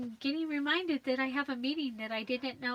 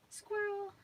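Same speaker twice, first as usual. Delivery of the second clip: high squirrel exclamation; 9 semitones higher than usual. Retold or imitated. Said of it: "It was like".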